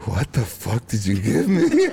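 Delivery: slow laughing imitation of mom; slow laughing imitation of a stoned mom; laughing mock mom. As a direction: laugh through the mom's question.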